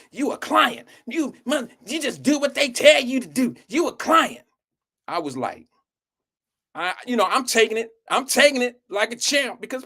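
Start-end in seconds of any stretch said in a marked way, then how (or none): 0.1-4.4 high-pitched mock taunting; in his face. Girl taunting the boy for doing what he's told, high and mocking. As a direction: imitate a girl needling you, pitched way up. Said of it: "was all in my".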